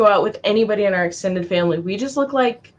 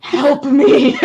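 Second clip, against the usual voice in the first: high, loud, laughing plea; hammed-up cry for help through laughter; mock plea; 5 semitones higher, 5 dB louder than usual. Poor mom with four kids, mock-desperate plea.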